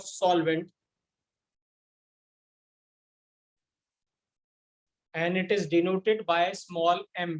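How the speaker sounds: slow, spaced-out dictation voice. Shown as a demonstration. Teacher dictating the definition to the class, slow and spaced. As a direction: read it out slowly so students can write.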